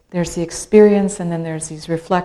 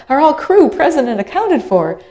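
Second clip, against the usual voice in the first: higher, louder mock official question; official roll call; 9 semitones higher, 5 dB louder than usual. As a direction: ask it like a harbor official checking in. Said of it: "And they said".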